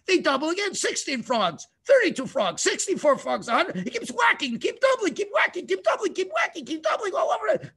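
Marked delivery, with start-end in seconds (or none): 3.8-7.7 building frenzy. A narrator's reading. Frenzied climax of story.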